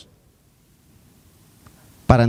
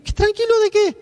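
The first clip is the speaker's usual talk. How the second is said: exasperated retort. Retold or imitated. Retorts to God's reassurance.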